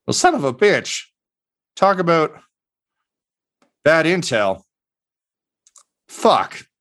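sit there and curse the bad luck; gruff goblin. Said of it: "and he just goes".

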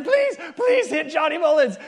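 high, laughing eager plea; excited pleading. Retold or imitated. Staff in the bushes willing the flashlight on, excited plea. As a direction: beg it to happen, giggling.

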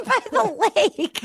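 high, slow, laughing through the words. Pretend. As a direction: giggle out the creepy line. Laughing repeat of ominous line.

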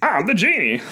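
high laughing genie voice. Pretend. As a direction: play a giddy genie. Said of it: "Give me some sound effects".

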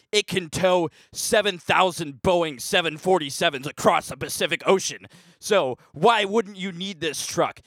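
cheesy ad announcer voice; fake truck commercial. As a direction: sell the truck like a hammy ad.

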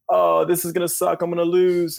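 whiny voice. Retold or imitated. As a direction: whine like you've already lost. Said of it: "that whiny voice that you just did".

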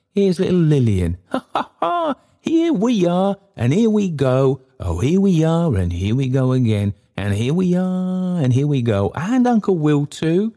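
put-on high, laughing play with a little child; playful delight. A narrator's reading. Trotty plays with little Lillian, gleeful and laughing. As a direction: bounce the child with glee. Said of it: "cried Trotty".

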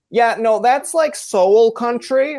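hammed-up teenager; mocking teenager. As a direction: drag out "soul country" like a know-it-all teen. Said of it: "if you ask a sixteen-year-old".

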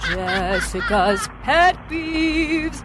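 mock announcement. Announcing the segment with a laugh.

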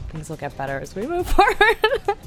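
normal talking voice

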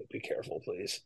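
very soft, almost whispered; hushed plea. Retold or imitated. Dad to son who jumped the curb, hushed plea. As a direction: imitate a dad pleading under his breath. Dad's weary reaction after curb jump.

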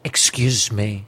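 slow, low theatrical voice with pauses; a hint of a Malkovich-like dad. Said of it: "catchphrases from my dad".

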